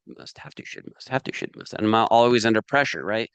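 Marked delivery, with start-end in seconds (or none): 0.1-1.7 the words drop to a whisper and die away; fading whisper. Shown as a demonstration. Chanting his inner pressure words, trailing into a whisper. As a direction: let the chant fade out to a breath.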